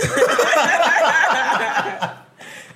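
high loud burst of laughter. Real, not acted. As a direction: crack up hard.

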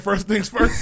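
laughing quote. Retold or imitated.

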